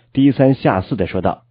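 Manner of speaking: plain reading voice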